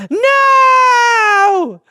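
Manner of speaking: high, loud wailing no; anguished kid cry. Retold or imitated. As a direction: cry out as your ant farm is shaken. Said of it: "you'd be like".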